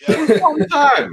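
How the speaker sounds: high squeaky mocking echo; mocking repeat.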